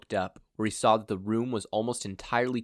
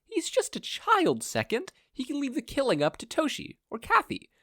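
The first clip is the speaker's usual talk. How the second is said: scolding. A narrator's reading. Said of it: "Nana scolded".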